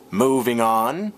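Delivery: drawn-out segue call. Acted. Vlogger belting a slow segue to viewers. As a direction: stretch the segue out and belt it.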